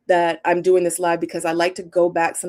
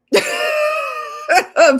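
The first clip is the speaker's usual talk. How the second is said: loud high laugh; 6 dB louder than usual. Real, not acted. Reading a cheeky chat comment, high loud laughter.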